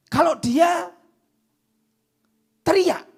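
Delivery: rising into a shout on teriak; loud demonstration yell; demo shout. Shown as a demonstration. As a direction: call it out like shouting across a distance.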